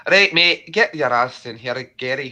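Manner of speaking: gruff-talking Scot ordering a mate over; Scottish accent. Pretend. Streamer summoning Gary in a mock Scottish accent. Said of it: "I'll show you your Scottish accent".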